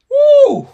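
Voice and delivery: sudden shrill high whoop, very loud; excited whoop. Real, not acted. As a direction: let out a joyful shout. Preacher erupts mid sermon.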